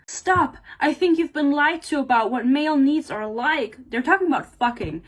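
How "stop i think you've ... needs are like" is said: high, emphatic acted interruption; protesting. Male partner interrupting heroine about male needs, protesting. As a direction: cut in and object firmly. Acting out a book quarrel's interruption.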